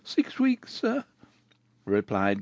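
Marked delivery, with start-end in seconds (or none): 0.0-1.0 put-on high girl voice, a touch of a shudder. Goualeuse answers Rodolphe, lightly shuddering. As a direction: answer with a faint tremor. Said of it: "shuddering as she spoke".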